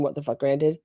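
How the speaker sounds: ordinary talk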